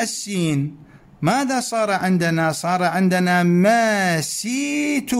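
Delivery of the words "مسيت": مسيت drawn out slowly as masit, the sin with a kasra; wrong form shown. Shown as a demonstration. Teacher slowly showing the faulty form masit. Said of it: "مسيت هذا لا يجوز عربية".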